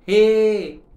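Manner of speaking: one loud, high, insistent "हे."; emphatic. Acted. Shubha insists to Gaju, loud and high. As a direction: thrust the word "हे." at him. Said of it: "ठासून म्हणाली".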